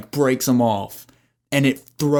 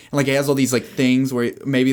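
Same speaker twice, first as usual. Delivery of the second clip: ordinary talk, nothing marked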